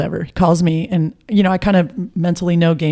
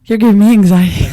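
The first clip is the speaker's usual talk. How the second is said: mock exasperated; 4 semitones higher, 7 dB louder than usual. Reacting to player's nerves.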